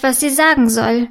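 usual speaking voice

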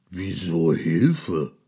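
lightly voiced slow monster; slow, puzzled monster; deep monster voice. A narrator's reading. Monster asks the mouse why help, in a slow monster voice. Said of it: "mit seiner tiefen Stimme".

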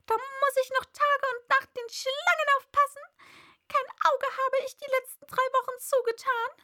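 high, loud bird voice, ranting; complaining pigeon. A narrator's reading. Pigeon ranting to Alice, high and loud. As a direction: rattle off the grievances, shrill bird.

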